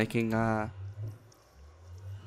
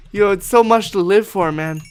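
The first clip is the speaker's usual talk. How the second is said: a touch higher and louder, hammed-up grief; mock eulogy; 9 semitones higher, 8 dB louder than usual. Gamer mock-eulogises the chicken with hammed-up grief. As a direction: grieve this line theatrically.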